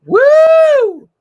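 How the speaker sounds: long, high, loud "woo"; mock celebratory whoop. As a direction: whoop it up like a cheering crowd. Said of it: "this is what it was like".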